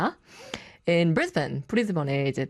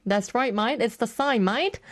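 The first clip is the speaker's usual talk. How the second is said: put-on higher, playful Aussie accent; cheery Aussie twang; mock Aussie accent; 5 semitones higher, much slower than usual. DJ confirming the answer in a mock Aussie accent.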